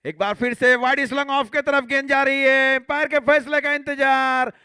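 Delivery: high, loud six shout; excited cricket commentary. Real, not acted. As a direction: roar the six to the crowd. Six hit in live commentary.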